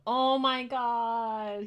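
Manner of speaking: overwhelmed. Real, not acted. Chimps emerge, overwhelmed.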